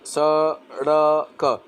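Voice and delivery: drawn out syllable by syllable, स, ड़, क; very slow, separated sounds of सड़क; sounded out. Shown as a demonstration. Teacher breaking down the word for learners, sounded out slowly.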